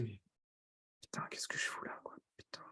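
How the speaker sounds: hushed, exasperated whisper; whispered inner thought. Acted. Seller regretting the meeting after three seconds, whispered aside. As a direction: mutter it voicelessly under your breath.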